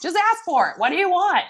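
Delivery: high-pitched mock exasperation; exasperated mom. Mom answering her son's endless hints, exasperated. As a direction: retell it fed up, voice jumping up.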